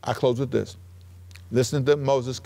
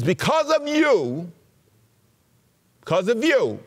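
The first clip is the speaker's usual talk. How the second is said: high, drawn-out mock blame; accusing; 6 semitones higher than usual. Preacher voicing Moses blaming the young Israelites, high and accusing. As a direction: stretch it out high, pinning the blame.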